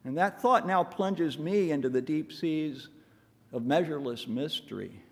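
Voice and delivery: usual speaking voice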